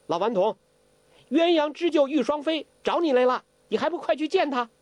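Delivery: put-on high, quick teasing young girl; sly girl. Huang Rong tricks Zhou Botong into leaving, sly.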